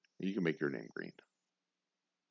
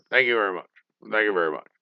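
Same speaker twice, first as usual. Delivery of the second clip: a loud, lightly voiced [name] catchphrase; hammed-up [name] sign-off; [name] thank you very much; 8 dB louder, much faster than usual. Old [name] thanking the crowd, loud mock impression. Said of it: "old [name]".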